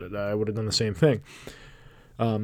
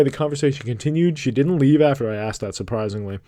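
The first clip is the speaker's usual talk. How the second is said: ordinary delivery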